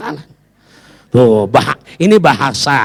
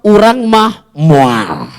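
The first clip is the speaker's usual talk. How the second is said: a slow, drawn-out Sundanese refusal; Sundanese sister; much slower than usual. Retold or imitated. Sister answering her sibling, slow Sundanese refusal. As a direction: drawl it like a Sundanese sister saying no. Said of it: "Orang Sunda udah ngomong sama kakaknya".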